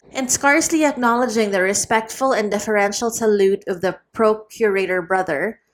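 ordinary delivery, nothing marked

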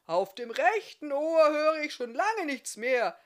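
half-deaf smith explaining his bad ears, shouting. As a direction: keep yelling like a man who can't hear himself.

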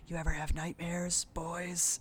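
a hint of a creepy killer taunting his victims; menacing villain. Villain taunting the boys he cornered, softly menacing.

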